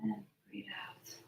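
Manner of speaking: very soft whispered exhale; slow deep breaths. Shown as a demonstration. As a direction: fade the words out on the breath.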